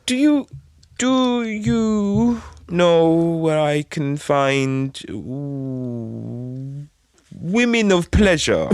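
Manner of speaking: high, very slow broken-English voice. Retold or imitated. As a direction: stretch each word out, groping through broken English. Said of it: "just in broken English".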